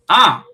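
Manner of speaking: startled yell. Real, not acted. Startled shout at mention.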